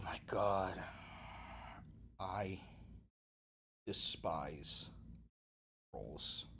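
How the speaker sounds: very low, soft, slow rumble; mock doom voice. Streamer mock-grimly declaring his hatred of trolls in a deep rumble. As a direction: intone it like a villain.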